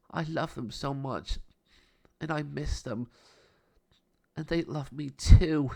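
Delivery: rising tearful plea, slowing at the end; sad. A narrator's reading. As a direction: voice climbing as grief builds. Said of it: "She sobbed".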